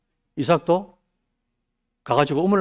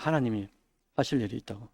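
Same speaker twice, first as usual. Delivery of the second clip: ordinary talk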